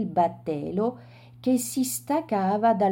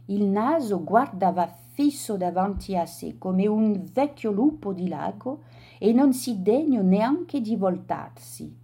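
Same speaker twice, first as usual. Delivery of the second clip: ordinary delivery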